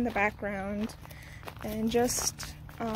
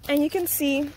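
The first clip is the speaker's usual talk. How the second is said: normal talking voice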